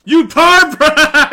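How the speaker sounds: slow, loud laughing yell of mock disgust; laughing outburst. Real, not acted. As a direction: bellow it through laughter. Mock outrage at character on screen.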